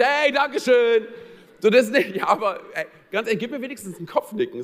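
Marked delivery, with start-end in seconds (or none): 0.0-1.1 high, loud, slow gushing thank-you; exaggerated cheery thanks. A driver rolling down the window to thank him, gushing and overdone. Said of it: "rausguckst, sagst".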